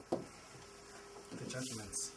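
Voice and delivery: slow breathy whisper of "The judgments". Shown as a demonstration. Repeats key word for emphasis.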